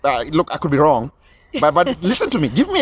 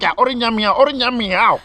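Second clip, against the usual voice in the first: higher-pitched, gabbled mock translation; mock interpreter; 6 semitones higher than usual. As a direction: imitate an overexcited interpreter, drawing out the last syllable. Mimics interpreter blowing it up.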